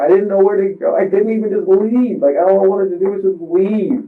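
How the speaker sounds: high, strained voice close to crying; pleading through tears; tearful. Real, not acted. Explaining to the investigator why he couldn't leave, tearful. Breaking down over the fall.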